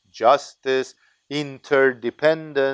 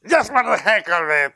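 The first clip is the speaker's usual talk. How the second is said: put-on bratty kid; 5 semitones higher than usual. Kid answers in the joke.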